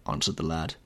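plain reading voice

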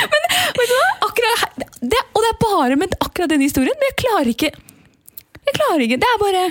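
high-pitched, struggling through laughter; helpless laughing. Real, not acted. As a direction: try to explain while unable to stop laughing. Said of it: "Og nå ler du". (hjelpeløs latter mens hun forklarer)

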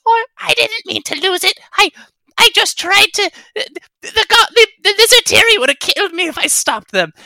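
high squeaky little monster, pleading fast; small ugly monster voice. Acted. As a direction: whimper it out like a tiny cowering creature. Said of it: "testing my range of small, ugly monster voices".

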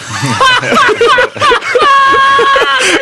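high helpless laughing fit. Real, not acted. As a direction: crack up hard. Laughing at the story.

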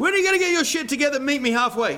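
high, loud, rapid-fire comic scolding; mock exasperation. Father in a mock meeting with his toddler, playful exasperated scolding. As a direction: scold in a rush, as a joke.

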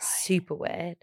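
unvoiced gossipy whisper. Real, not acted. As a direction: hush it like a secret.

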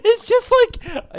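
high-pitched, drawn-out laughing words. Real, not acted. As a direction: squeeze the words out through laughter. Laughing while butting in on story.

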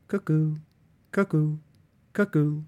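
high, loud sing-song cuckoo calls, each falling; cuckoo clock call. A narrator's reading. The mantelpiece clock cuckooing the hour, high loud bird call.

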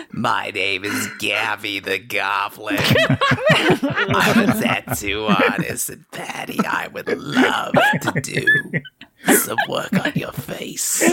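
hammed-up grinning goblin squeal, slowed. Goblin tattoo artist squeals her offer to Patty. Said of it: "The goblin grins at you and says".

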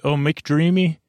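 ordinary talk, nothing marked

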